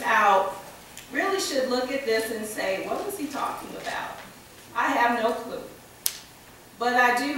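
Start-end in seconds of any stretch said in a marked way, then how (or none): 2.9-4.2 puzzled aside. Retold or imitated. Future generations puzzled, imagined quote.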